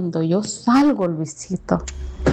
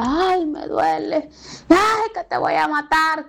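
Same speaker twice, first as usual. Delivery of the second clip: imitating a beaten woman's pained, high, slow cries; pained cry imitated; 6 semitones higher than usual. Narrator imitates the battered neighbor crying out in pain, high and slow. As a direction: retell her crying out in pain, pitched up and drawn out.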